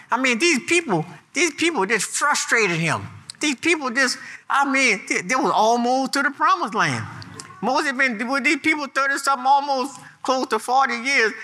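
building sermon fervor; rising preaching. Acted. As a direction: preach it up with growing heat.

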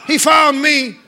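high, loud revival preaching with stretched-out phrases; full-force preaching. Real, not acted. Preacher leading a congregation to answer the Lord, exultant shout. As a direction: declare it in triumph, letting each phrase ring.

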